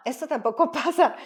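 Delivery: amused, giggling denial; laughing. Real, not acted. Host laughing with guest that it never works, giggly.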